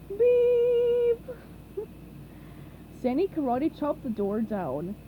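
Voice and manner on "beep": vocal beep. A narrator's reading. Patrick imitates a beep.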